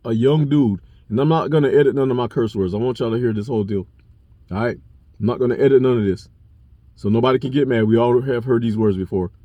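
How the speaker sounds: ordinary delivery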